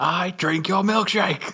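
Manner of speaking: slow, high, rising movie bellow; Daniel Day-Lewis. Acted. Co-host riffing on the oil, hammy actor impression. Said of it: "They're going full Daniel Day-Lewis".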